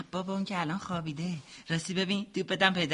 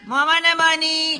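loud, high call across the distance; loud high call to a mother far away; 8 semitones higher, 10 dB louder than usual. Acted. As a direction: shout for mum to hear you. Calling mother from afar.

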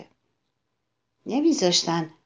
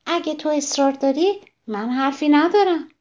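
higher, other-voiced calm wasp; 7 semitones higher than usual. The wasp answers the ladybug's challenge in a higher voice. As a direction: answer coolly, stinger ready.

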